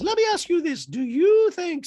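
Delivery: loud, high falsetto; mock housewife interrogation; Dorit impression voice.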